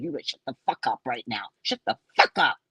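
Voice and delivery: low-pitched, imagined scolding; imitated stern mother's telling-off; tongue lashing. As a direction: scold fast and low, then push harder. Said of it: "giving him a tongue lashing".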